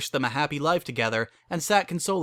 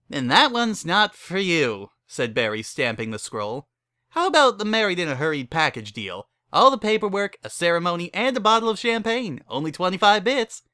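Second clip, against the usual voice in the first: brassy saleswoman; 6 semitones higher, 4 dB louder than usual. Acted. Barry pitches her wedding packages to the couple, brassy and higher. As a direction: rattle off the package menu like a hustler.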